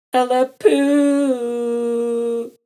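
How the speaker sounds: very slow, high, loud Ella poo; drawn-out shout. A narrator's reading. Rascal shouting while flying over Ella. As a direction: holler it long across the sky. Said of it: "Rascal shouted".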